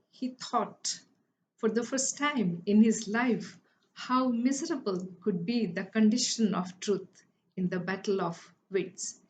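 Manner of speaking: ordinary delivery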